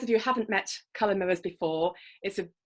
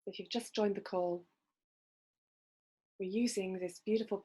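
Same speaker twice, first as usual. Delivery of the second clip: guided meditation; 6 dB quieter than usual. Acted. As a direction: gently guide listeners into calm. Leads a breathing visualization.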